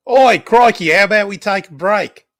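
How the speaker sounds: loud, high hammed-up Australian; mock Australian accent. Player trying an Australian accent after saying he can't. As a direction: belt out a cartoon Australian, way up high. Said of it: "I can't do the Australian accent".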